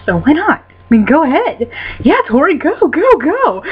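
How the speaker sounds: high, excited egging-on; giddy mock cheerleading; playful cheering. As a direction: cheer her on, pitch leaping up on each go.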